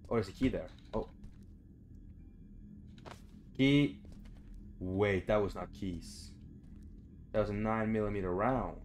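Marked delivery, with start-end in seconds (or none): none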